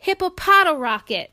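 high and loud, proud "hippopotorocket"; proud hippo. Acted. Sheila thanking friends, proudly loud and high. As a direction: show off the new rocket with glee.

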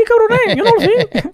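high, loud mock boxer's protest; imitating Julio. Mimics Julio denying his speech.

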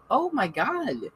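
mock-shocked nurse finding antibodies. Nurse reacting to Lily's test result, high mock shock. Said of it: "Comes back, she goes".

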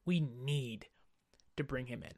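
said low and soft, under the breath; quiet mutter. Retold or imitated. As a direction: drop the voice to a murmur. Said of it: "I'm just not entirely sure you say".

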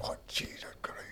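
retold whispered "oh no"; aghast, voiceless aside; horrified whisper. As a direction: let the realisation sink in under your breath. Caller realises it is really Burns.

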